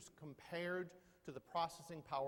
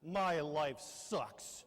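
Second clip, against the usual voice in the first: put-on slow sulky teen; 6 dB louder, much slower than usual. Appliance ranting like a sulky teen.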